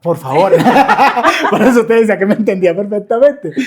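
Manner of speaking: loud, high burst of laughter through the words; laughing hard. Real, not acted. Joking about his partner Gary, laughing loudly. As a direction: crack up while getting the words out.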